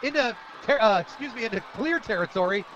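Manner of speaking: high, loud football call; excited play-by-play. Real, not acted. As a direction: call a breakaway run live. Calls the play live.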